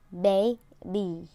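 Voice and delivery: put-on baby ending in a smiling long E; model word baby. Shown as a demonstration. Teacher modelling baby with the long E. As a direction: smile on the end of baby. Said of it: "So it has to smile".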